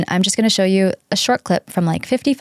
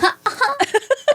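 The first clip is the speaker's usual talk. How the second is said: high-pitched burst of giggles; a quick squealing laugh. Real, not acted. Giggling at birthday chat.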